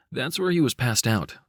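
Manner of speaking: ordinary delivery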